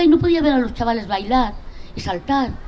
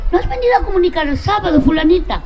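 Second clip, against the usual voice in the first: higher, fast, cheerful gossip voice; imitating chatty mothers; 6 semitones higher than usual. Mothers chatting at the prison door, high cheerful gossip. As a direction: play the mothers chatting at the prison gate, lightly. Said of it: "y decían".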